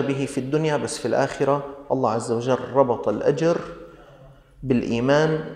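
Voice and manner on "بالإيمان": ordinary delivery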